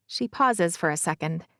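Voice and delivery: plain reading voice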